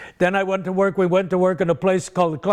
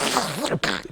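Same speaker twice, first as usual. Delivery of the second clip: demo cough; 5 semitones higher, 5 dB quieter than usual. Shown as a demonstration. And I was catching cold.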